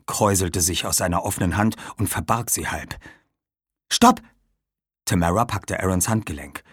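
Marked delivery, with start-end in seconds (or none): 3.9-4.2 very high, loud cry; urgent stop. Acted. Tamara grabs Aaron's wrist, urgent stop. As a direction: halt them with one sharp shout.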